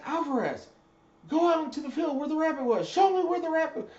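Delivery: barked shout, way up high and loud; high, loud drill instructor shout; drill instructor yell. Retold or imitated. Drill instructor yelling orders.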